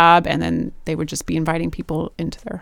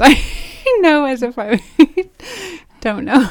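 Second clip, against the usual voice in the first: laughing through words; 7 semitones higher, 7 dB louder than usual. Real, not acted. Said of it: "It's funny asking you".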